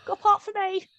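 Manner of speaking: high, chirpy mock plea; eager asking. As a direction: laugh while begging for a role. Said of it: "mimicking friend asking for part".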